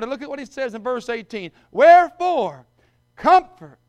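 loud proclaiming, climbing to a high cry on Wherefore, comfort; preaching scripture. Acted. Preacher crying out the comfort verse to the congregation, loud and high. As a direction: build the verse up to a ringing peak. Preacher reads Thessalonians aloud.

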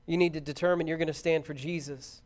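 earnest preaching appeal. Real, not acted.

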